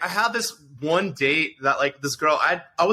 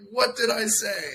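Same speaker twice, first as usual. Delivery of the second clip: higher-pitched, hammed-up protest; mock bewilderment; 7 semitones higher, slower than usual. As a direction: act out baffled innocence. Protests after friend's insult, retold.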